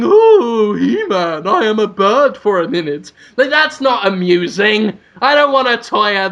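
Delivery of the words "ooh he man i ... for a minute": lightly voiced sorceress, loud wailed opening; mock sorceress. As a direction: put on a slightly hammed-up sorceress voice. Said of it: "A woman just going".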